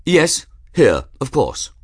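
high, loud, cocky reply; offhand challenger. A narrator's reading. Duelist confirming his second is present, offhand. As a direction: answer up high, brushing it off. Reggie impatiently answers the official.

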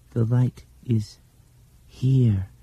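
put-on high, slow, faraway child voice; a blind girl sensing the light; dreamy little girl. A narrator's reading. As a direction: let the words drift out of a trance. Dinah's dreaming voice.

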